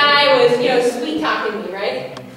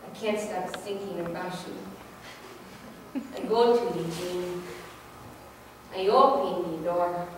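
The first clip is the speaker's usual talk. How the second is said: mock sultry; 5 semitones lower, 12 dB quieter, slower than usual. Imitating guy's romantic text.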